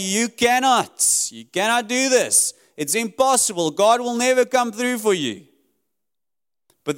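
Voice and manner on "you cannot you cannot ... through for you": higher-pitched voice of doubt, insistent; discouraging voices. Acted. Voices telling believers they cannot do it, raised and insistent. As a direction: press each discouragement home on a raised pitch. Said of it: "all those voices that tell you".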